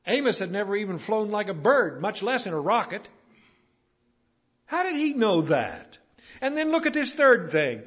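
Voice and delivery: high, loud preacher's delivery; forceful preaching. Real, not acted. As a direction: drive each phrase home from the pulpit.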